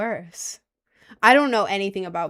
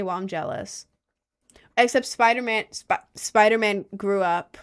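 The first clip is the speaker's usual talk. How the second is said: ordinary talk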